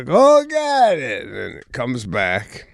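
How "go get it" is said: high, drawn-out "go get it" to a dog; sing-song call for fetch. Retold or imitated. Throwing the ball to dog.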